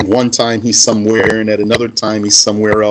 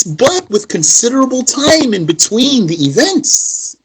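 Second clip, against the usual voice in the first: mocking know-it-all; 11 semitones higher than usual. Mimicking the weak scholar lecturing.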